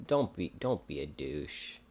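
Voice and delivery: soft, slow half-hearted pushback; meek quiet protest. Retold or imitated. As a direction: mumble it back timidly. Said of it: "Which I'm like".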